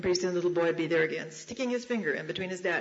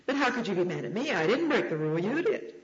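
incredulous mother; 3 dB louder than usual. Acted. Mother questioning her angry four-year-old, incredulous. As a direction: protest in lively, singsong disbelief.

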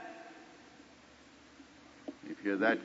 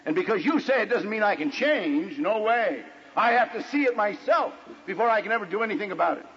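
higher-pitched, emphatic protest; stubborn husband re-enacted; retold insistence; 8 semitones higher than usual. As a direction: dig in and argue the point. Retold argument with wife.

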